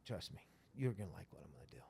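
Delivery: secret whisper. Retold or imitated. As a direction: let him in on a secret. Zach's secretive reply.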